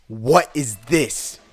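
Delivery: stern question. Retold or imitated. Teacher showing the picture, demanding slowly and loudly. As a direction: press each word slowly. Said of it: "he just goes".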